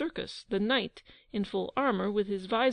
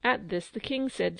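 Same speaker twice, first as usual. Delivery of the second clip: ordinary delivery, nothing marked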